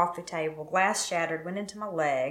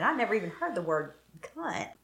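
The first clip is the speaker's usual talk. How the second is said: ordinary delivery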